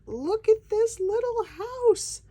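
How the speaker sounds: high, loud delighted squeal; excited squeal. Real, not acted. Unboxing a handmade gift from an artist, high excited squeal. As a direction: squeal over the tiny house.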